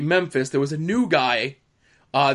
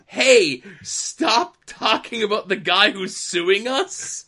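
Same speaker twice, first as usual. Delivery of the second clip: mock angry order, slow and raised in pitch; slow, higher mock outburst; retold blown gasket; 4 semitones higher, slower than usual. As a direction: imitate an exasperated boss, spacing the words out. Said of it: "Vince apparently is blowing a gasket".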